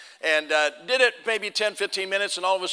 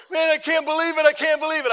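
loud, higher, overjoyed gushing; so excited; 10 semitones higher, 8 dB louder than usual. Retold or imitated. First-time hunter running over after his first kill, loud overjoyed. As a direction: burst with a first hunter's thrill. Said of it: "he was so excited".

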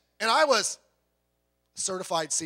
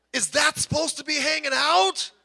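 high, shocked disbelief; alarmed question; 8 semitones higher, 4 dB louder than usual. Acted. Bystander asking the injured man, high and appalled. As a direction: gasp it out in horror at the wound. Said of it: "You don't look at him and say".

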